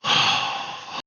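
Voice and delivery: long, breathed-out sigh acted for a character; heavy acted exhale; deep sigh. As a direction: let out a deep, wordless sigh. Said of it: "lets out a deep sigh".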